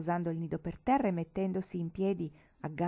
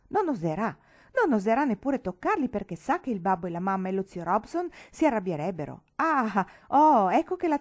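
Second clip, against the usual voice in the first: louder, higher mocking triumph; sing-song louder boyish taunt; 4 semitones higher, 8 dB louder than usual. A narrator's reading. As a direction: gloat that you've trapped her. Boy taunts the governess.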